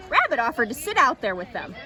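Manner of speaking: usual speaking voice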